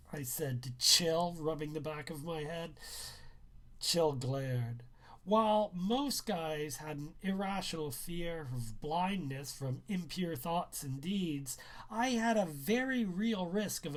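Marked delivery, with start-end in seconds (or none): none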